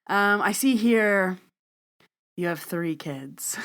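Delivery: slow, drawn-out divination; palm reader's reveal. Acted. Palm reader telling the man about his kids, slow mock reveal. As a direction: stretch it out for suspense.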